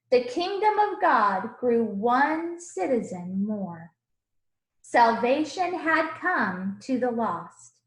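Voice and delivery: put-on lively rhyming read-aloud for children; storybook reading. A narrator's reading. Teacher reading a picture book to the class, animated. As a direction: read the verses with bounce and warmth.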